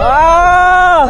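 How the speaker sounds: full-force ride scream. Real, not acted. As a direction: let out a real scream. Ride drops.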